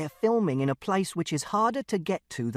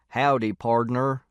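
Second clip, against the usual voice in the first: slow, low cowboy greeting; loud, drawn-out mock cowboy voice; joking cowboy drawl; 4 semitones lower, 4 dB louder, slower than usual. A narrator's reading. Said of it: "which is a joke he does".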